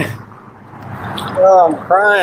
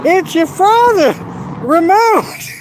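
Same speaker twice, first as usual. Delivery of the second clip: very high mock exclamation; movie character imitation; 12 semitones higher, slower than usual. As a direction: cry it out in a high put-on voice. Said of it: "in the one depiction?".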